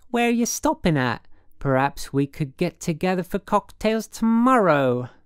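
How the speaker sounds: high, loud, eager woman's voice; affected city woman, sociable. A narrator's reading. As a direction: angle sweetly for a later date.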